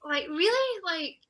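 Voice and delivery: disbelief. Retold or imitated. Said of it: "disbelief at winning".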